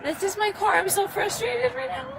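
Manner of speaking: upset driver near tears, pleading; crying. Real, not acted. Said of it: "crying ain't gonna do any good".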